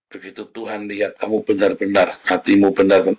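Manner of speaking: ordinary delivery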